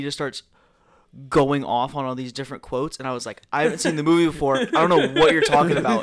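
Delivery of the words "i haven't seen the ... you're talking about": higher, louder, breaking into laughter; retold bewildered protest. Friend baffled by Will's quotes, mock exasperated protest.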